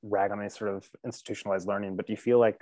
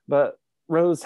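usual speaking voice

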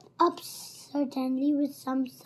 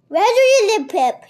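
loud, raised interrogation; menacing demand; 8 semitones higher, 13 dB louder, faster than usual. A narrator's reading. Captor interrogating a captive boy, loud menacing demand. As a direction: fire the question out forcefully.

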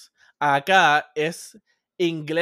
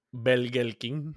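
Puerto Rican accent; 5 semitones lower, 5 dB quieter, much slower than usual. Shown as a demonstration. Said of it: "Dime algo".

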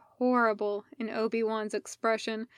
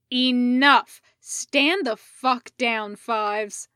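commanding shout; 7 dB louder, slower than usual. Acted. Rex ordering Fives to stand down.

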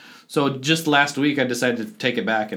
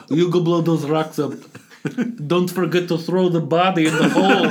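louder mock villain accent with a laugh in it; Gru-like accent; 5 semitones higher, 4 dB louder than usual. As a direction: give orders like a cartoon boss, hammed up. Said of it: "you sound like Gru".

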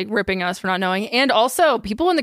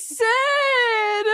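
excited squeal; 13 semitones higher than usual. Real, not acted. Excited greeting of grandma.

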